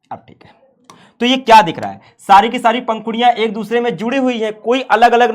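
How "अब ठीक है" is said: whispered aside. Real, not acted. Muttering to himself.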